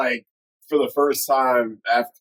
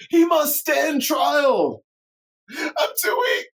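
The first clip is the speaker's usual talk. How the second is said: much higher, cracking mock-crying plea; hammed-up high wailing plea; whiny pleading Palpatine; slower than usual. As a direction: shriek and whimper like a beaten old Sith.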